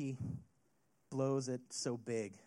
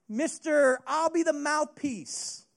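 usual speaking voice

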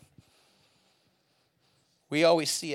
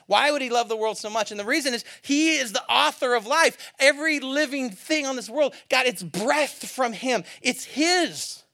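higher, louder, rising preacher's fervour; preaching at full force; 12 semitones higher, 9 dB louder than usual. Acted. Pastor telling the congregation why God loves the world, fervent preaching. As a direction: lift the room with it.

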